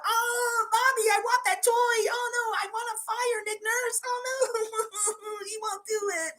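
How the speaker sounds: high, wailing spoiled-brat voice; whiny crybaby. Pretend. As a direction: whimper like a kid denied a toy. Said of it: "Be little spoiled brat".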